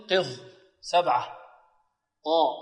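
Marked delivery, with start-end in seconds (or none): none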